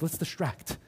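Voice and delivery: almost under the breath; fading hush. Retold or imitated. Disciple trailing off nervously, barely audible. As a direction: let the plan die away into a murmur. Repeated, fading to near silence.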